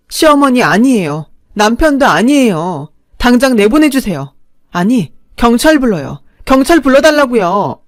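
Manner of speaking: loud, high frantic demand; new mother pleading with nurses, loud and frantic; desperate furious plea. Acted. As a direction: beg them to call the police. Narrator begging nurses to call police.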